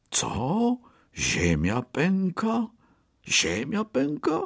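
high, rising disbelieving question; startled questioning. A narrator's reading. Second rabbit hears the earth is cracking, startled questioning. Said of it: "zawołał drugi królik".

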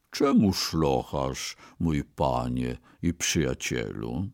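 low, soft, slow consoling voice; gentle concern. A narrator's reading. Ox at the window to his sobbing master, gently concerned. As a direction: ask tenderly, deep and hushed.

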